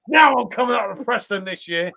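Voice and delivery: normal talking voice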